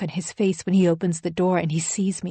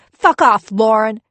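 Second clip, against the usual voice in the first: furious shout; 4 semitones higher, 10 dB louder than usual. A narrator's reading. Snatching arm back, furious.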